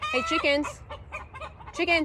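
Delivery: high, lilting chicken call. Real, not acted. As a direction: coax the hens over in a high sing-song. Calling chickens.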